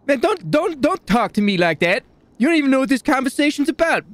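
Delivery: high, fast, indignant other voice; mock argument with himself. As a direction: snap back at yourself as a second character. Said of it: "role-playing with myself".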